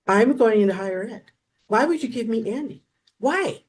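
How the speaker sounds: high, pleading complaint; whiny protest. Retold or imitated. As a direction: complain to the boss that it isn't fair. Retelling his protest to Lisa.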